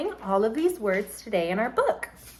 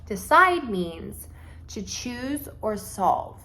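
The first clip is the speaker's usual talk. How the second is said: slow definition; slower than usual. Shown as a demonstration. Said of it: "slow definition for students".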